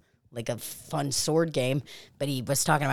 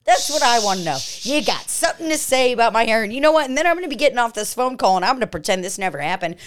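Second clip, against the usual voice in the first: angry; 6 semitones higher, 5 dB louder than usual. Acted. Angry rant at caller.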